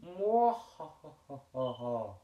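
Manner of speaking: slow drawn-out villain cackle; evil laugh. Acted. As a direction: cackle like a cartoon bad guy. Villain introduces himself.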